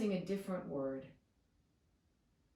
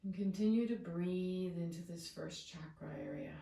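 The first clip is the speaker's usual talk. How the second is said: soothing, settled meditation guide; guided meditation; 3 semitones lower, 4 dB quieter than usual. Real, not acted. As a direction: ease them into stillness, long pauses between phrases.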